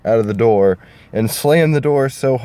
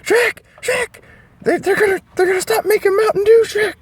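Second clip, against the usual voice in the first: squeaky falsetto, fast and much higher; panicked cartoon donkey, very high and louder; Donkey, crying; 3 dB louder than usual. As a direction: wail it out in a silly squeaky falsetto. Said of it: "crying his eyes out saying".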